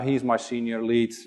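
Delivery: ordinary talk, nothing marked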